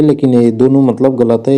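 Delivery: usual speaking voice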